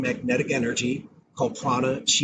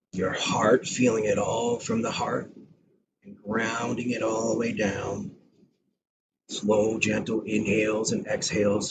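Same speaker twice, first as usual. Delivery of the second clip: guided meditation; 3 semitones lower than usual. Acted. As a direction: ease listeners gently into their breath. Leads a breathing meditation.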